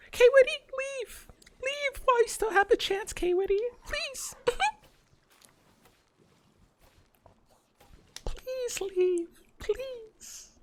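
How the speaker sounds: put-on very high cutesy e-girl voice; sweet high-pitched streamer girl. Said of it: "E-grill voice".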